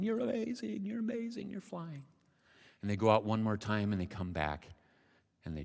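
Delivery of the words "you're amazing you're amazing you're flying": high-pitched distant-crowd cheer; amazed cheering. Acted. Parents and friends watch the frog fly, cheering.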